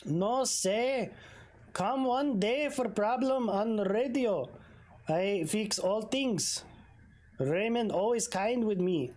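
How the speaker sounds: put-on broken English with a light Spanish accent; lightly accented mechanic. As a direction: Pedro defending Raymond, light Spanish accent. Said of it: "why am I giving him a weird accent".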